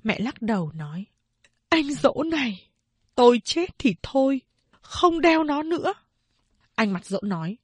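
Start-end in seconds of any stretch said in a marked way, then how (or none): 1.7-5.9 put-on higher, pleading old mother. A narrator's reading. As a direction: beg him to stop. Mother voiced in story.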